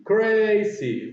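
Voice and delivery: CRAZY in two separate, drawn-out beats; split into syllables. Shown as a demonstration. Teacher repeating the two syllables, CRAZY pulled apart. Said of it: "Two syllables".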